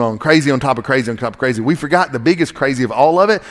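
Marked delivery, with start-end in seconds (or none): none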